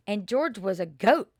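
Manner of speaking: ordinary delivery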